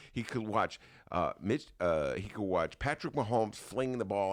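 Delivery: ordinary delivery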